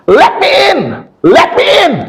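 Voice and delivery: high-pitched screech from behind a door; imitated frantic woman's scream; woman yelling outside. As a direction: shriek to be let in, very high. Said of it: "ada perempuan teriak".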